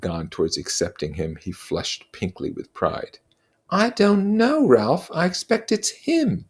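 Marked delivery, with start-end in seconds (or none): 3.7-6.4 high, earnest schoolboy voice, slowing to insist; a fussy boy pleading for fairness; Piggy's voice. Acted. Piggy answers.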